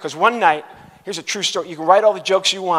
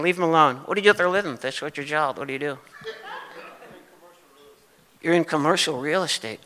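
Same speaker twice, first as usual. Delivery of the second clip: usual speaking voice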